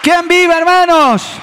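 loud, high call to the crowd; rallying call. Real, not acted. Pastor calling out to the church, loud and rising. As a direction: rouse the brothers with a cry. Preacher calls out to congregation.

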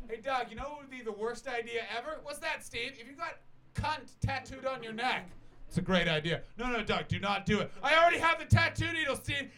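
high, loud, escalating mock dialogue; two idiot pals egging each other on; hammed-up excitement. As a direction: build to a high mock yell. Acting two excited friends with bad idea.

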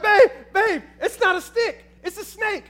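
high, loud urgent alarm; panicked warning. Retold or imitated. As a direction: warn your wife, voice cracking high. Warning his wife of snake.

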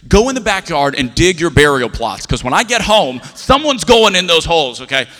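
loud, over-the-top dad threat; angry dad threat. Acted. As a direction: bellow a mock threat at the kids. Father threatens kids over phone.

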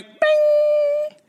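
very high, sustained "bing"; held high-pitched chime; imitated alert ping. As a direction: sing out a high bell-like ding. Imitates alert ping on hearing "life.

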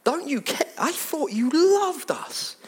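high, hurt, begging voice; wounded plea to Jesus; pleading disciples. Acted. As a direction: plead like a betrayed child. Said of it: "they basically say to him".

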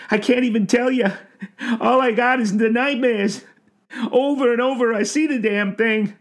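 high-pitched tearful confession; anguished old man, stammering and blubbering; crying. A narrator's reading. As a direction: confess it haltingly through tears. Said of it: "The old man cried".

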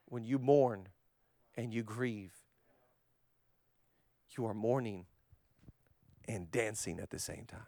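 soft, low, with long pauses; hushed reflection. Real, not acted. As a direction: speak gently into the grief. Tender reflection on grief.

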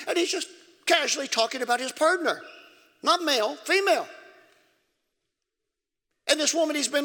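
high, loud pulpit preaching; preaching at full force. Acted. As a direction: preach it hard to the congregation.

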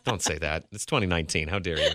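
low, mock-offended scolding; mock outrage. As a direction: pretend to be scandalised.

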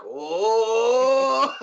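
high, loud mock gasp of the crowd. Bar crowd gasping at the risky grab, mock gasp. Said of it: "Everyone's like".

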